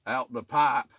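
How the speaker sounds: mock country drawl on pipe as "pah"; bad country accent. Shown as a demonstration. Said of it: "Almost had a bad country accent right there".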